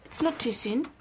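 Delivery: put-on comic South African accent. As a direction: argue back in South African. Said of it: "South African accent".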